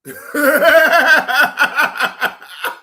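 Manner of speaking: big loud burst of laughter; cracking up loudly. Real, not acted.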